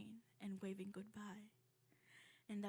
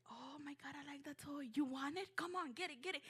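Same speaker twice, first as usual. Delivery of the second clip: higher, eager retelling; excited toy shopping; 6 semitones higher, faster than usual. Retold or imitated.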